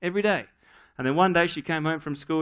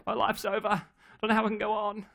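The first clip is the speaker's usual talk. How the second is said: put-on dramatic child; 4 semitones higher, 3 dB quieter, faster than usual. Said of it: "She's very dramatic".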